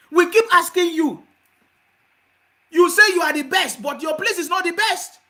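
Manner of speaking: heated loud reproach; angry shout. Real, not acted. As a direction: yell it at them in rebuke. Host berating the people he criticises.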